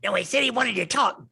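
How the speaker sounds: goblin voice. Acted. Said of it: "too tired to do voices".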